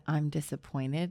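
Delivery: low, slow mock parental disappointment; disappointed-parent line. Friend quoting the line mom gave her son, mock-solemn disappointment. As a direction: intone it like a let-down parent. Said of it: "you gave him the".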